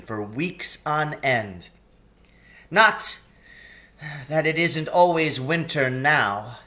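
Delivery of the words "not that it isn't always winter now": gloomy. A narrator's reading. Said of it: "he added gloomily".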